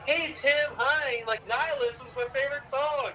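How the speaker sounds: gushing fan. Retold or imitated. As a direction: play the starstruck, overly nice fan. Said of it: "They were all, like, really nice".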